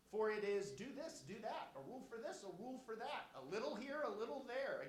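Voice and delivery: mock him like a nagging nursery rhyme; mocking baby talk. Leaders mocking Isaiah's teaching, sing-song baby talk. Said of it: "this kind of mocking baby talk".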